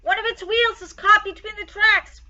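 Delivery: high, loud driver's shout; panicked driver. A narrator's reading. Driver still shouting for help.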